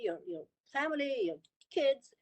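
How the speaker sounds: ordinary talk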